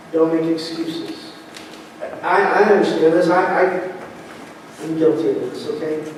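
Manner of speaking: ordinary delivery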